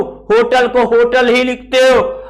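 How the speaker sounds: usual speaking voice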